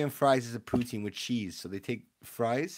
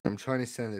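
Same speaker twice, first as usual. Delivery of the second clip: ordinary talk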